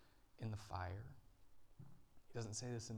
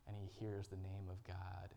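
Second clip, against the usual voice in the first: ordinary talk, nothing marked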